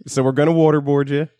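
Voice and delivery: high mock-cheerful orders; mock grandma. Grandma ordering kids into the car, high mock voice. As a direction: brightly announce the punishment.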